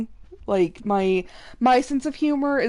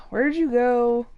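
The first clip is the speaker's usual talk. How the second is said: normal talking voice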